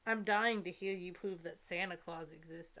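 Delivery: ordinary delivery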